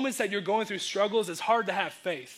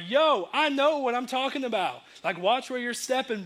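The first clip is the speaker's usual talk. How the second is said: higher-pitched mock indignation; argumentative; 5 semitones higher than usual. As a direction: push back like someone in an argument. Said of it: "like, those arguments".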